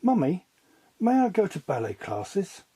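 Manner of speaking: a hint of a child asking sweetly; eager little girl. A narrator's reading. Debbie asking her mother for ballet classes, lightly voiced girl. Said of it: "asked Debbie".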